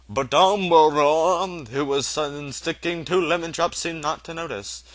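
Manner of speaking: usual speaking voice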